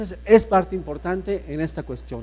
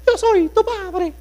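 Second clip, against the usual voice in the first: much higher, slow squeaky Mickey-style voice; 3 dB louder, slower than usual. Shown as a demonstration. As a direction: squeak it out like a cartoon mouse. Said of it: "ahora dice Mickey". (con voz de Mickey, muy aguda y lenta)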